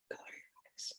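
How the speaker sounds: barely audible, voiceless whisper. Shown as a demonstration. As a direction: whisper it as if leaning in very close. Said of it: "And I whisper".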